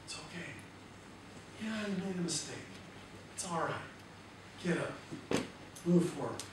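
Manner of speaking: soft, gentle encouragement; true inner voice. Shown as a demonstration. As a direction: whisper it kindly to yourself. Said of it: "true part of you that's whispering".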